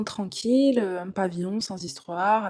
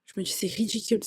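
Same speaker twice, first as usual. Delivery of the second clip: ordinary talk, nothing marked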